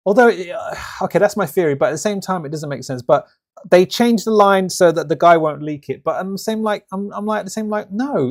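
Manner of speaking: ordinary talk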